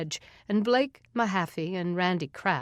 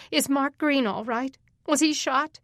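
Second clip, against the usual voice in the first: urgent plea; 6 semitones higher, 3 dB louder than usual. Acted. Pleading with deputies.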